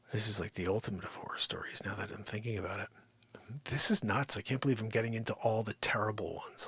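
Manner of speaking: hushed, breathy confession; whisper. Real, not acted. Realtor confiding a horror story to viewers, whispered. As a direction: lean in and whisper it.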